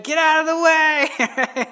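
high, loud shout to clear the way; mock yell. Impatient father shouting at the crowd, loud and high. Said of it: "yelling and screaming and saying".

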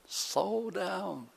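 a soft, high-pitched calming word to an angry master; hushed plea to slow down. Retold or imitated. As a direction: gently soothe a furious boss.

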